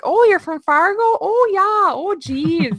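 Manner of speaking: imitating a Minnesota accent, high and sing-song; mock Minnesota, Oh geez, you betcha, lilted high; Minnesota accent impression. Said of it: "all you heard was like".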